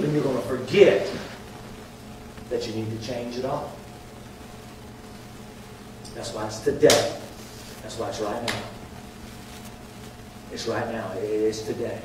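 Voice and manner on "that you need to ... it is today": lowered, softer earnest urging; quiet appeal. Real, not acted. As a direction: bring it down low. Preacher drops to earnest appeal.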